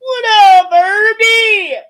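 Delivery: big loud high call across the room; loud hyped shout-out to a friend; shouted greeting. Real, not acted. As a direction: yell the greeting out to your buddy. Calling out to a friend.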